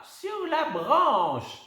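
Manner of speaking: very high, slow, drawn out; insisting, pointing; old lady pointing. A narrator's reading. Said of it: "précise la grand-mère". (grand-mère qui précise, lente et aiguë)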